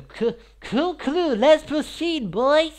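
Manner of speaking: high, loud excited yell; hyped shout. Real, not acted. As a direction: burst out with excitement.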